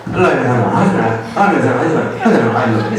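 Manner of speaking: hurried, laughing run of I love you husband; too fast. Shown as a demonstration. Monk mocking a wife's rushed I love you, laughing. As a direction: rush the words together, half laughing. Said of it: "This is too fast".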